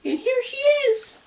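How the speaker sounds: very high, slow squeak; slow high squeaking cub; tiny Baby Bear squeak. Acted. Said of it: "squeaked in his tiny little voice".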